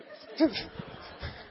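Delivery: high, airy burst of laughter; high breathy laugh. Real, not acted. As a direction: let a real laugh break out. Laughs at his own story.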